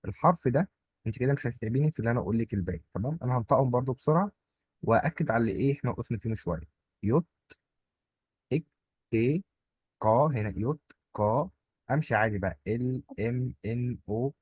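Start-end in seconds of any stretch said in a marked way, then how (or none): none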